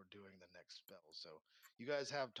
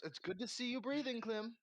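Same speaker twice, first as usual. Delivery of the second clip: high, slightly loud character voice; pointed innkeeper greeting; 10 semitones higher, 5 dB louder than usual. Acted. Innkeeper to the adventurer back alive, pointed and high.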